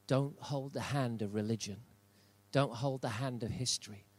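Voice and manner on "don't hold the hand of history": normal talking voice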